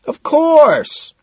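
very high, loud yell; high-pitched holler; shout. A narrator's reading. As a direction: whoop it back over the music. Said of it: "Madison shouts".

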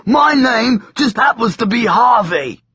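high, loud mocking demon. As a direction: sneer it like a demon. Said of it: "said the demon".

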